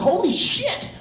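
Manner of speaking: squeaky high shocked exclamation; amazed teen. Retold or imitated. Fifteen-year-old watching the movie, squealing in amazement. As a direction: blurt it out in awe. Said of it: "and I was like".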